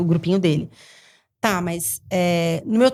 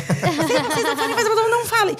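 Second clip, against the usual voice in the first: much higher, laughing, mock-stern warning; mock scolding; 11 semitones higher than usual. Mother mimicking a warning to the other children, laughing and high. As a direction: wag a finger at the kids while half laughing. Mocking adults telling kids.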